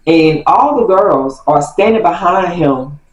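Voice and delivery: usual speaking voice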